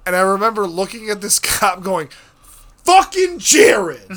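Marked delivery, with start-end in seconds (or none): 2.8-4.1 high, drawn-out furious shout; retold scream. Retelling his yell at the cop, screaming. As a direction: scream the name in outrage at the cop. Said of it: "Just screaming at him".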